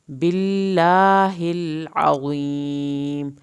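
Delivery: stretched tajweed recitation; Quran recitation. Shown as a demonstration. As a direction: chant it out slowly with a full heavy ṭā. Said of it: "کھڑی زبر کھینچیں گے".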